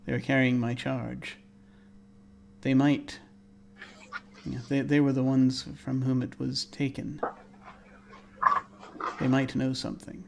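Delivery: hushed, even, soft murmur; whispering voice. Acted. Unseen presence telling Juliet who might know, soft murmur. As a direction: speak softly, leaving long pauses. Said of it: "the voice whispers to you".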